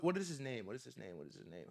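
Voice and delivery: fading out. Real, not acted. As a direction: let it die away as you search memory. Repeats question, fading away.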